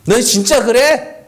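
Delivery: needling disbelief; mocking sarcasm. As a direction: draw it out as a jeering question. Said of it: "비아냥거리는 거예요".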